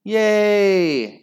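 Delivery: high, loud cheer; shriek. Acted. Class cheering about the cup, shrieking. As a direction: whoop like excited kids. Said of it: "shrieked the class".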